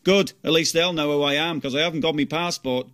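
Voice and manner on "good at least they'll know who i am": ordinary delivery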